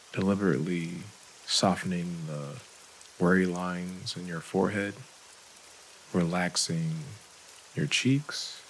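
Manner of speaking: calm, unhurried meditation voice with long pauses; soothing breathing-exercise guide; guided meditation. Real, not acted. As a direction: ease them through each breath, leaving space after every phrase.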